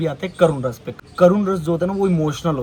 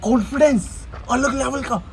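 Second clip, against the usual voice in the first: slow, higher, lightly amazed; acted wonder; 6 semitones higher, slower than usual. Practicing wonder for the camera, slow mild amazement. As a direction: linger in mild awe. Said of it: "ये आ गया wonder".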